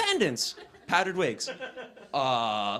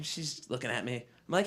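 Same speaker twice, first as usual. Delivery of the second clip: ordinary talk, nothing marked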